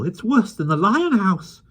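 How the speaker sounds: high, slow fretting voice; fussy zoo keeper. A narrator's reading. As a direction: fret at the chaos. Winkle's fussy complaint.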